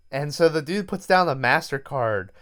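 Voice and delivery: ordinary delivery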